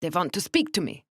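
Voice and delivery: put-on precise, stiff thick German accent. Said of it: "Her accent was the thickest".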